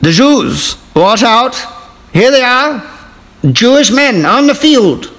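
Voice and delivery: high, slow forties newsreel voice; old newsreel announcer. Acted. Mock newsreel announcer introducing players, high and slow. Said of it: "forties announcer".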